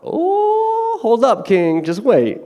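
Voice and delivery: high, loud oh; excited interjection. Real, not acted. Preacher cutting in on the king, excited. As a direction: cut in eagerly. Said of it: "preacher interjects at the king".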